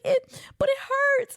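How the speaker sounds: high, drawn-out mock whine; slow, high-pitched pitiful kid; crying child. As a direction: imitate a little boy milking the pain. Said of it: "so he's crying and".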